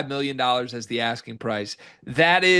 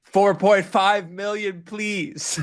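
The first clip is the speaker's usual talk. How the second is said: high, slow, smug agent demand; 6 semitones higher, slower than usual. Pretend. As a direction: lay out the price like a cocky agent. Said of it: "I'll be Des Claude's agent".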